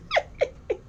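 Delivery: brief high laugh; a quick real chuckle; laughing it off before backing down; short laugh. Real, not acted. Laughs again before kidding.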